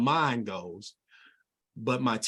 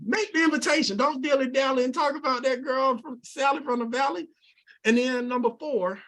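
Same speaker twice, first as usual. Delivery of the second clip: excited; 10 semitones higher than usual. Real, not acted. Excited teaching step three.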